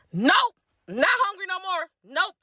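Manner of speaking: high, sarcastic clipped refusal; sulky nope. Retold or imitated. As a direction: pout through the refusal.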